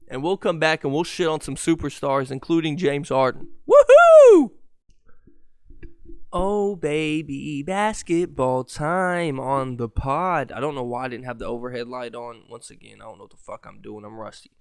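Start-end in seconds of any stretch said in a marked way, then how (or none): 3.7-4.5 high loud whoop; excited whoop. Real, not acted. Excited shout starting basketball segment. 6.3-10.5 slow hype announcer. Acted. Slow hype intro to segment.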